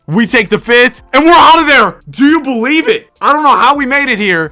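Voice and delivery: high, loud ecstatic shriek; shrieking jackpot winner. Real, not acted. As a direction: scream with disbelieving joy. Clinching the series win.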